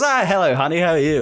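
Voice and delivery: put-on chirpy old lady spotting a familiar face; little old lady greeting. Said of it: "this little woman just walks past and goes".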